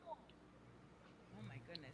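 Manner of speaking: whispered. Real, not acted. Aside after outburst.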